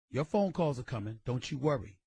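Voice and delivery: ordinary delivery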